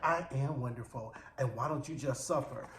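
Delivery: low, soft icy put-down; Hawk's soft cold jab at the snitch; cold quiet retort. Retold or imitated. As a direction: say it low and cutting.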